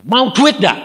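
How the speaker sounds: high, loud mock call; loud blunt offer. Preacher acts out offering money to a businessman, loud call. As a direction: throw the question out high and loud.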